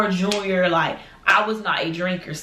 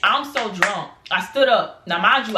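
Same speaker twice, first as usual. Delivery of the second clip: normal talking voice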